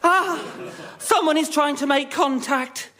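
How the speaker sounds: ordinary delivery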